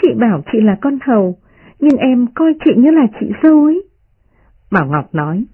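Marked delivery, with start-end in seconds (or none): 0.0-3.9 put-on high, playful young lady; lightly voiced girl keeping up the tease; smiling teasing girl. A narrator's reading. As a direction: insist playfully, rising high. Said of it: "Đại Ngọc cười nói".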